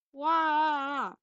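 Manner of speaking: loud, high crying wail; baby wail. A narrator's reading. Little boy wailing to his mother, loud high cry. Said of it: "And he cried".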